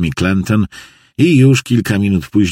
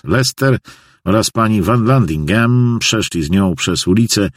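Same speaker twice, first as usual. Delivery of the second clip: ordinary delivery, nothing marked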